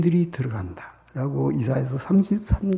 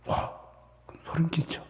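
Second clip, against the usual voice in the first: usual speaking voice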